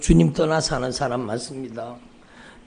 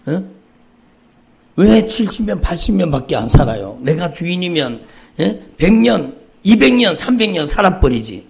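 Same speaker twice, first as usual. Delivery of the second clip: mocking build; 3 semitones higher, 8 dB louder than usual. Real, not acted. Pastor mocking the thought of being one's own master, loud. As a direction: push it up, mocking the idea. Mocking argument, rising numbers.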